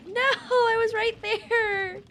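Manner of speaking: high, loud, drawn-out wail of protest; dismayed yelling. Real, not acted. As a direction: cry out in disbelief at losing. Knocked back in game.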